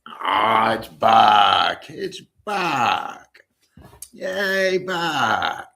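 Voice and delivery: light up as the dog arrives; excited greeting. Real, not acted. Host greeting his dog Buck on camera, thrilled and loud.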